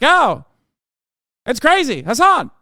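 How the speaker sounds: put-on high, loud old-time mid-Atlantic accent; God, mid-Atlantic accent. God welcomes the streamer to heaven, hammed-up mid-Atlantic accent. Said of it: "God had a mid-Atlantic accent".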